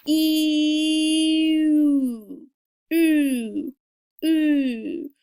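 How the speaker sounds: slow "E" turning to "ooh" with rounding lips; E sliding to French u. Shown as a demonstration. Teacher modelling the French u, E to ooh. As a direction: glide from "E" into "ooh". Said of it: "to being into the O shape".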